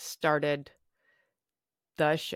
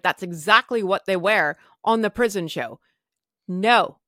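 retold refusal; 2 semitones higher, 7 dB louder than usual. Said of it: "I'm like".

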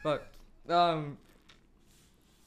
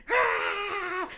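mock scream; 13 semitones higher than usual. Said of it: "she's like".

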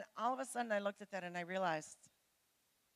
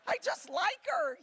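put-on higher, whiny voice of a tearful thirteen-year-old; lightly voiced boy blubbering to the pastor; crying boy; 8 semitones higher, 5 dB louder than usual. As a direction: play the scared kid near tears, pleading. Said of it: "this one little thirteen-year-old boy started crying".

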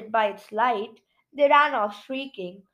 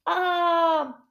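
gasp; 6 semitones higher than usual. Pretend. Blossom falls asleep.

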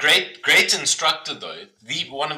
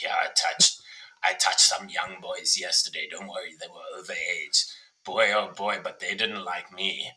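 mock instructor; 6 semitones lower, 4 dB quieter than usual. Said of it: "he'll be like".

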